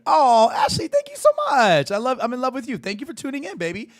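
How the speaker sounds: very high, delighted squeal easing down; squealed, effusive gratitude; gushing thanks. Real, not acted. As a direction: gush with love at a loyal fan.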